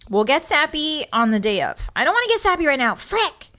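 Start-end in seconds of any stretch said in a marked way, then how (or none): 1.9-3.5 fast, higher, rushed words fighting back tears. Real, not acted. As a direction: push through before the tears win. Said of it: "You guys are making me cry".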